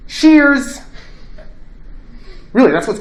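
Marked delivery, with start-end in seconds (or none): 0.1-0.8 bright mock toast, much higher and louder; cheerful toast. Socrates toasting with the hemlock, a loud cheery cry. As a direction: raise the cup and cry it out. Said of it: "And he goes".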